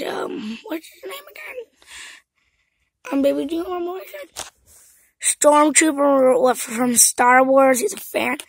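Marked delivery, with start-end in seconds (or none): 5.2-7.8 slow loud announcing. Pretend. Toy friend introduced.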